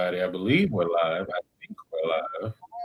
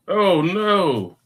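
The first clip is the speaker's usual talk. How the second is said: mock dismay; 11 semitones higher, 6 dB louder than usual. Stream audio failing.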